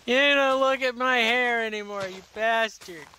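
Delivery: shrieking high, loud fake fury; mock outrage. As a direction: screech it in hammed-up anger.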